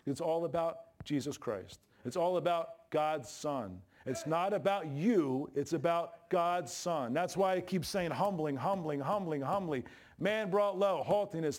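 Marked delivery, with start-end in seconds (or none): 2.0-7.1 each word slow and weighted; slow emphatic preaching. Acted. Preacher slowly drilling the point home to the congregation. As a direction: lean on every word, slowly.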